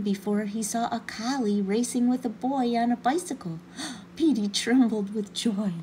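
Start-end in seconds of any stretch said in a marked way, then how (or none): 3.6-4.1 sharp excited gasp. Acted. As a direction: gasp in delight. Petey sees boy on bicycle.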